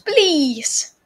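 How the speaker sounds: normal talking voice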